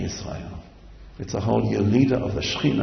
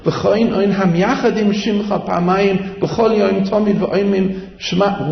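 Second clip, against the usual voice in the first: higher, louder chanted plea; fervent pleading prayer; 8 semitones higher, 5 dB louder than usual. Acted. Preacher voicing the congregation's plea to God, fervent.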